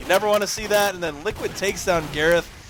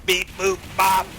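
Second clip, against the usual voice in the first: robot voice; 3 semitones higher than usual. Pretend. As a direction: beep boop robot bit. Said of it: "Duder is to a robot again".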